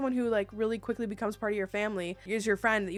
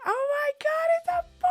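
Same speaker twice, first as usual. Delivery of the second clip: very high excited cry; overjoyed squeal; 5 dB louder than usual. Retold or imitated. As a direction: re-enact squealing at the big news. Retold gender reveal moment.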